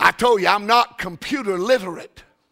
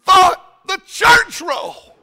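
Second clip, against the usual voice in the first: high, loud, hammed-up wail; distressed secretary; 11 semitones higher, 6 dB louder, slower than usual. Preacher voicing the secretary in a hammed-up wail. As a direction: play the panicked secretary.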